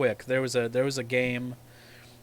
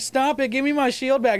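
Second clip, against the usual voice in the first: higher, louder mock of a whining child; whiny kid voice; 11 semitones higher, 5 dB louder than usual. Mocking kids in a VR game, high whiny child voice. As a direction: whine like a kid who lost his shield. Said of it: "and they'd be like".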